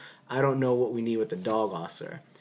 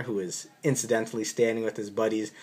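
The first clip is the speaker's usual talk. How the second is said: usual speaking voice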